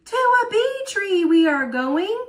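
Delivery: high, loud breathless call; panted out over the shoulder; puffing. A narrator's reading. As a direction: Einar calling out while pedaling hard. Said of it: "Einar Tundevold puffed".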